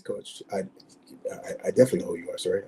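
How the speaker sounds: hushed and rushed; nervous whisper. Retold or imitated. Answering the famous coach's call, awed whisper. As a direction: whisper it fast, intimidated.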